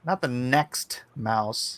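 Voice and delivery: "Not" hit hard, slow and soft; stress on NOT. Shown as a demonstration. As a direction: lean on "Not" slowly. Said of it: "Not sex mouse".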